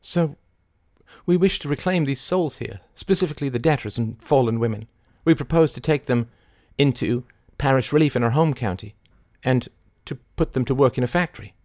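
high-pitched woman's character voice; high, assured official pitch; Mary, businesslike. A narrator's reading. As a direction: put your proposal formally and briskly. Voices Mary stating her business.

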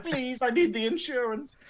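high-pitched mock begging; pleading pedestrian. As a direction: put on a desperate little plea. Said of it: "And she's like".

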